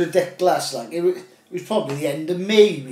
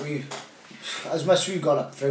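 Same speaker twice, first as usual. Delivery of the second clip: normal talking voice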